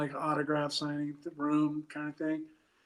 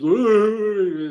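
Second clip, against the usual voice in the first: high, loud mocking cop "Ooh"; dumb cop; 4 semitones higher, 11 dB louder than usual. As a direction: sneer it like a dopey cop. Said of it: "his dumb cop voice".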